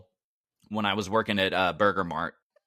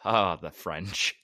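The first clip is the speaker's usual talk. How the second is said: weary sigh; 6 semitones lower, slower than usual. Retold or imitated. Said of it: "I'm sitting there thinking".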